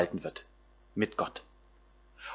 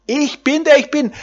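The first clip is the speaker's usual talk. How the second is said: high, ringing declaration of God's name; exultant proclamation; 13 semitones higher, 5 dB louder than usual. Acted. Preacher to his listeners, proclaiming God's name high and ringing.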